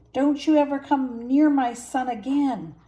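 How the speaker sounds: loud furious warning; angry king roaring at hyenas. A narrator's reading. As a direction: roar them off your son. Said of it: "Mufasa roared".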